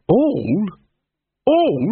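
high, rising incredulous cry; outraged disbelief. A narrator's reading. Gaspode outraged at the question.

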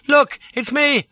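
high, loud, urgent yell; roaring. A narrator's reading. Man on the coach step to Lola, roaring. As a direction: shout to make someone recognise you. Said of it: "I roared".

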